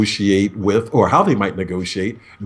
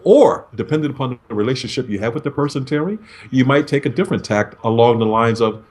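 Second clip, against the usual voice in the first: usual speaking voice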